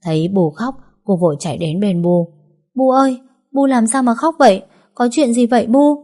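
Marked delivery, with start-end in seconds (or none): 2.8-6.0 put-on worried little girl. Little girl asks crying mother.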